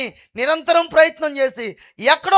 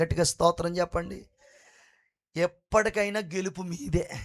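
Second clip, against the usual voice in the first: normal talking voice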